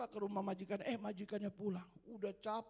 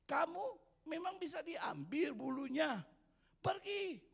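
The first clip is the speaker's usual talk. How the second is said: high, loud bragging sheep voice; boastful sheep; 7 semitones higher, 4 dB louder than usual. Acted. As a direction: look down on the puppy as the sheep. Sheep boasts and rejects dog.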